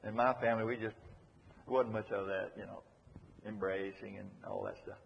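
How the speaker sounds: lightly voiced reticent man, low and trailing off; a hint of an awkward, undemonstrative man; reluctant man. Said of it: "Some man will say".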